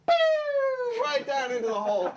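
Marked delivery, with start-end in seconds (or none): 0.1-1.0 very high "pew" of something flying off; cartoon plummet sound, high-pitched; falling whistle noise. Imitates creature flying down the hole.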